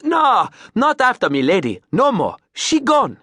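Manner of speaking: high, loud Creole exclamation of mock horror; mock horror, Creole accent. Captain denying it's named after his wife, Creole accent. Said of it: "look of mock horror".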